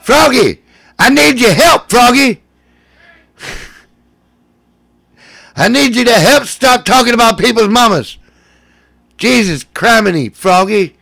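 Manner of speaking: high-pitched, loud pleading holler; mock desperate yelling. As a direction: holler for help, hammed-up. Mock yelling at Froggy for help.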